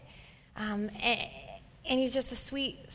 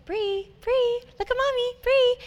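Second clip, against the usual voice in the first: put-on much higher, sing-song mom calling baby's name; cooing mommy; 14 semitones higher, 6 dB louder, slower than usual. Parent calling her baby's name after the cochlear implant, high cooing. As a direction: coax the baby to look at mommy. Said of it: "a parent saying their name over and over".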